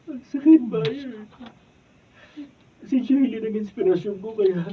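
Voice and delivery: loud, high pained cry; heartbroken cry. Acted. Daryl to Maya, heartbroken over Jaylene, anguished cry. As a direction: cry out the hurt.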